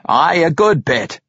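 happy reply. A narrator's reading. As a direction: agree happily, as a hearty dwarf. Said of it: "Parson Glaive happily replied".

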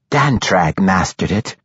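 understated spite; spiteful. A narrator's reading. Matron mother putting Anzarel down, spitefully. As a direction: remind him with a touch of spite. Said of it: "reminded him spitefully".

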